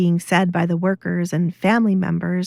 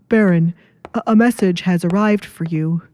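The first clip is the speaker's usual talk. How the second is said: urgent butler; 3 dB louder than usual. Acted.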